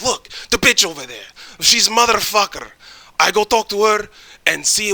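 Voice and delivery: mad friend. Retold or imitated. Friend pointing out a girl at the bar, imitated as mad. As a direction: imitate your friend's worked-up complaint. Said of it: "He gets so mad".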